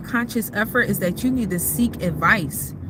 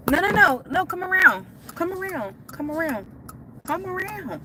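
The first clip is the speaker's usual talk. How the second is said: calling out; 9 semitones higher than usual. Real, not acted. Calling to husband outside the car.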